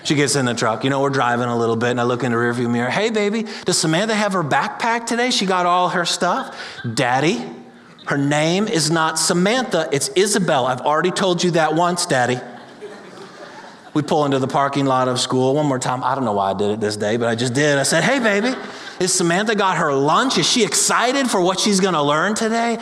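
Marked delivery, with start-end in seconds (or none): 2.9-6.5 higher, sing-song playful dad; dad glancing in the rearview mirror, teasing. Retold or imitated. Dad teasing daughter again. 6.9-12.4 put-on mildly fed-up kid setting Daddy straight; exasperated child. Daughter correcting dad again. 18.0-22.8 much higher, bright mock-cheery dad; dad in the school parking lot, teasing his daughter. Dad teasing a third time.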